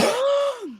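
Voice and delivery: a high gasp of being moved; sharp emotional gasp. Retold or imitated. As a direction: gasp as if tears are coming. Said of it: "I was like".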